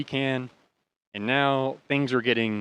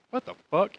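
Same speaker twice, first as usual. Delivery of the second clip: surprised; 8 semitones higher than usual. Retold or imitated. Bar packed, unexpected.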